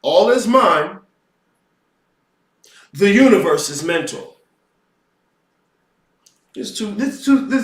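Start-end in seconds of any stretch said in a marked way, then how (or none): 0.0-4.4 "All is mind." then a long pause before "The universe is mental."; deliberate pause. Shown as a demonstration. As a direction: leave a clear gap between "mind" and "The universe". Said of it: "You heard my pause. I put it there for a reason".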